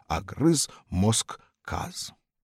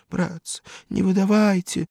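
crying plea; 11 semitones higher, 5 dB louder than usual. A narrator's reading. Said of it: "плакал Фисухин".

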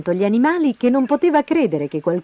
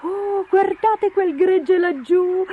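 high, gleeful, itching bird; excited emu; 13 semitones higher than usual. Acted. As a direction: squeal with longing to flutter off. (emù eccitato, voce acutissima)

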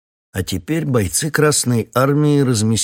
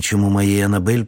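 plain reading voice

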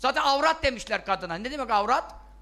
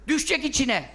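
ordinary delivery

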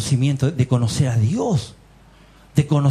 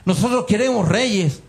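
lightly voiced crowd's demand, pitched higher; demanding people; 6 semitones higher than usual. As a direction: clamour for it as the people of Israel, mildly acted. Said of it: "ahí teníamos a Israel que decía".

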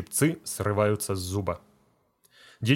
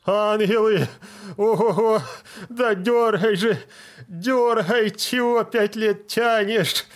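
much higher, louder anguished yells, dragging the last words; screaming in pain; 11 semitones higher, 5 dB louder, slower than usual. Acted. Deacon in the chair yelling at the feldsher, much higher and louder. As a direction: howl at him to just pull it. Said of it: "Кричит".

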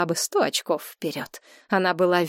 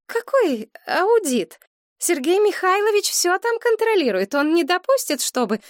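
indignant mother; 12 semitones higher, 3 dB louder than usual. A narrator's reading. Mother brushing off her daughter's question about an audit, indignant. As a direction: dismiss it as absurd.

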